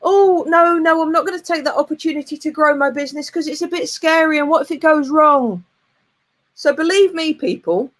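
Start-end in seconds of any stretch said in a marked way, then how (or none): 0.0-5.6 mock scared whine. Imitating a fearful business owner, mock panicky whine. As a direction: fret like someone dodging a chance.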